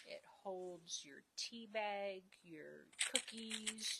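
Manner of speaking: ordinary delivery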